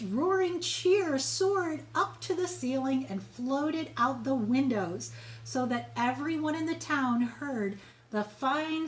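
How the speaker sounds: plain reading voice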